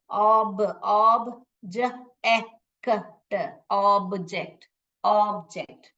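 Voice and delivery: "ऑब्जेक्ट" split into "ऑब, ज, ए, क, ट" then joined; sounding out letters. Shown as a demonstration. Teacher blending "ऑब्जेक्ट" sound by sound for the class. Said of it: "देखिए जोड़ के पढ़ना आ रहा है".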